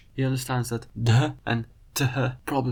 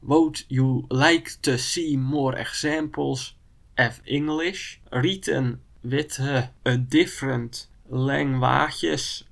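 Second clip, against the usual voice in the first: slow, careful reading by Dutch sounds; Dutch spelling read; 3 dB louder, slower than usual. Shown as a demonstration. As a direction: read every word as Dutch spelling gives it, slowly. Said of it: "I'm gonna just pronounce it as written".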